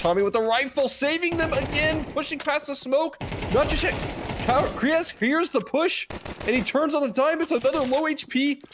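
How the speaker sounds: high, rising hype call; excited play-by-play. Real, not acted. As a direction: shout the clutch as it happens. Caster hypes late clutch.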